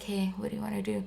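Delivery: very soft, quick question; soft-spoken sweater. Retold or imitated. Sweater asking the son what he wants, softly. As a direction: ask gently in a small, quiet voice. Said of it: "He talks really softly like this".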